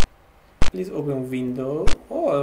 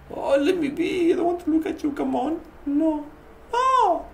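high-pitched, squeaky whine; scared pleading; 14 semitones higher, 4 dB quieter than usual. Real, not acted. Player begs the game figure, high scared whine. Refuses to look at scary face.

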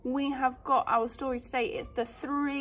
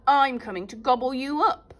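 louder, menacing troll; troll shout; 7 dB louder than usual. Acted. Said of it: "shouted the troll".

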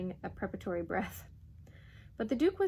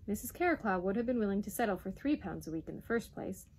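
plain reading voice

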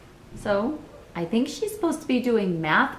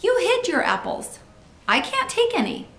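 put-on high, protesting child voice; child's yelled complaint; 11 semitones higher than usual. A narrator's reading. Janet accusing Steve of hiding apples, high protesting voice. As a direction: complain in a raised, high pitch. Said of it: "yelled Janet".

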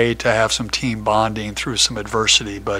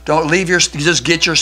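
loud, higher repeated commands; shouting get out; 5 semitones higher, 9 dB louder than usual. Retold or imitated. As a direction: hurry the team off the bus. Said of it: "it's like".

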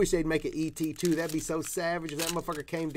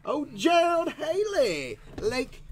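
excited; 12 semitones higher, 4 dB louder, slower than usual. Real, not acted. Reacting to pulled card.

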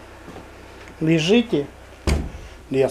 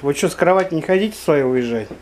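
usual speaking voice

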